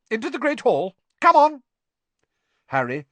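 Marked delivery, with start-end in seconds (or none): 0.1-1.6 high, loud command to a crowd; McGonagall, sharp. A narrator's reading. Teacher herds wet pupils into the hall, sharply. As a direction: herd them in sharply, louder on the last words. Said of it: "said Professor McGonagall sharply".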